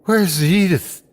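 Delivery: anxious calling. A narrator's reading. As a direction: call out for her. Old man calling for Edith.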